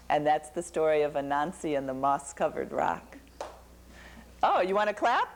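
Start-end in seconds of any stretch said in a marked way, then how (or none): none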